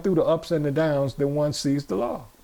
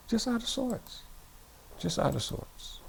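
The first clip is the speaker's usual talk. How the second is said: very soft, dwindling repeat; hushed, half to oneself; out of sorts; 7 semitones higher, 10 dB quieter than usual. Acted. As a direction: let the volume drop right away. Acting out being out of sorts.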